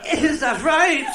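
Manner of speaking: put-on high, slow calling old woman; old Cork woman. Old woman calling to her friend over the partition, high and slow. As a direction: call over the toilet partition. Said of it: "did the other one".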